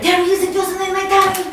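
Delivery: fast, excited whisper-quick line; hurried child voice. Shown as a demonstration. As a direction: blurt it out like a secret. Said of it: "Repeat after me".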